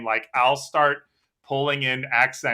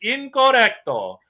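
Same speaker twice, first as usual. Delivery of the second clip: high, loud, drawn-out verdict; hammed-up classroom correction; disapproving Spanish teacher; 10 semitones higher, 6 dB louder than usual. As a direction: imitate a teacher marking it wrong. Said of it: "They're like".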